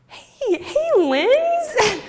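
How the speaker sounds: high, surprised hey with a laugh; puzzled sister. Retold or imitated. Sister greeting her drunk sibling, high and puzzled. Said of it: "she's like".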